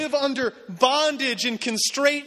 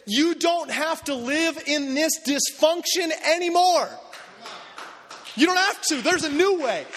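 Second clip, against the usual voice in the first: fervent declaration; 6 semitones higher than usual. Retold or imitated. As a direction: proclaim freedom with conviction. Ambassadors declaring freedom.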